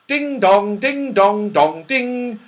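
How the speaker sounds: sing-song chiming ding dong; lightly voiced chiming clock; clock chime. A narrator's reading. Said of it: "said the clock".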